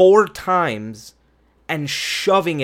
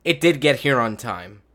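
ordinary delivery

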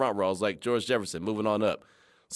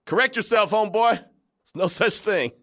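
mock scolding; 7 semitones higher, 3 dB louder than usual. Host scolding callers jokingly.

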